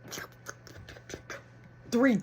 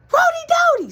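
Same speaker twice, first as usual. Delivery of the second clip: shouted name, pitch way up; dad hollering one last time; loud high call; 12 dB louder, much faster than usual. Retold or imitated. Dad calling out once more.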